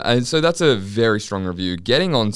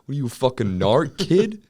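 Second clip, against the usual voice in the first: higher, harsher scolding father; angry dad voice; 5 semitones higher than usual. Retold or imitated. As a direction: bark it like a furious dad. Imitating angry dad scolding son.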